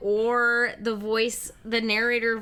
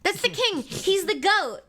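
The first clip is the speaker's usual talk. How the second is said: excited; 9 semitones higher than usual. Real, not acted. Emphatic high agreement.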